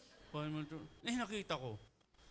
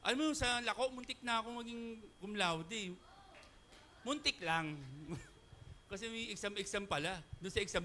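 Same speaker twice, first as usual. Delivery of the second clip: usual speaking voice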